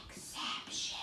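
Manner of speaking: whispered "exception". Shown as a demonstration. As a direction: breathe it out like a secret. Teacher whispers rule word.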